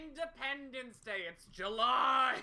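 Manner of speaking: high, loud hammed-up proclamation; playful over-the-top holler; mock triumphant shout. Joking shouted festival announcement.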